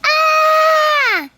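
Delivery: high, loud frightened scream; scared scream. Pretend. Townspeople scared by the creepy mask, loud high scream. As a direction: shriek like someone saw the mask. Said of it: "Can you guys do that for me?".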